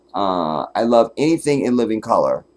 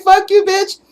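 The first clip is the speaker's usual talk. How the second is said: high, loud laughing outburst; mock yell; 7 dB louder than usual. Reacting to the talking dog, loud and high mock shout. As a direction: shout it in delighted disbelief. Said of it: "I was like".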